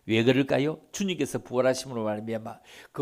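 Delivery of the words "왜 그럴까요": ordinary talk, nothing marked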